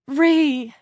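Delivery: high, loud cry of a name; relieved exclamation. A narrator's reading. Claudia seeing her cellmate wake, relieved exclamation. Cellmate's eyes snap open.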